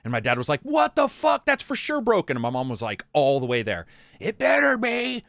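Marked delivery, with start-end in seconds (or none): 0.6-2.4 high-pitched imitation of shocked dad; dad's alarmed shock. Dad seeing the swollen hand, imitated high shock. 4.2-5.2 high, slow mock-mom voice; nagging mom. Mom all the way to hospital, high imitated nagging.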